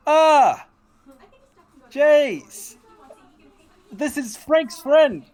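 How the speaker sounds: high, loud, eager exclamation; excited introduction. Acted. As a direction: introduce a friend with great delight. O'Flynn excitedly introduces friend to Chase.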